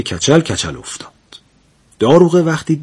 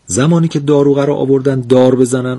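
plain reading voice

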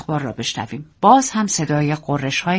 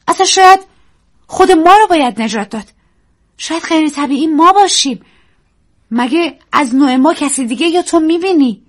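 high loud defiant; heated; 10 semitones higher, 5 dB louder than usual. Acted. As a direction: argue back passionately. Daisy arguing heatedly. (اعتراض پرشور و بلند)

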